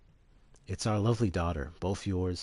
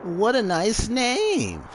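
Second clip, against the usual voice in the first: much higher, slow and louder gloating; creepy mad scientist; 12 semitones higher, 3 dB louder, much slower than usual. Acted. Hojo gloating to Gast about the sample, slow, high and creepy. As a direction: savour every word like a greedy villain.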